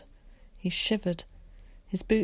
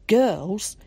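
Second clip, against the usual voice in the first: louder, appalled echo; appalled disbelief; 3 semitones higher, 7 dB louder than usual. A narrator's reading. Mother hears her son's friends are girls, appalled. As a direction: throw it back in outraged disbelief.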